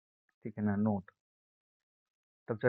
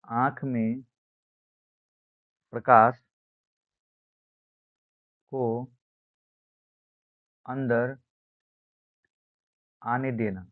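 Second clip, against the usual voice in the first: word by word, long pauses between; spaced-out classroom dictation; slow dictation; slower than usual. Shown as a demonstration. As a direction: dictate it for note-takers, pausing after each chunk.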